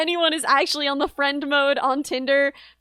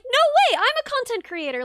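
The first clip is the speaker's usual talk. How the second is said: high, eager "no way"; excited; 9 semitones higher than usual. Retold or imitated. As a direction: play it overexcited. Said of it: "And I was like".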